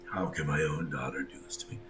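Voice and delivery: whispered, wounded disbelief; hurt mother. Acted. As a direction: breathe it out in pain.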